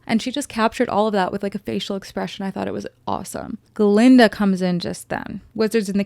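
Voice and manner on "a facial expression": normal talking voice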